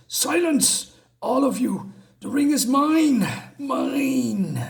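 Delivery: Wotan's furious refusal. Acted. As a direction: roar it like a god clutching his treasure. Wotan refuses to give up ring.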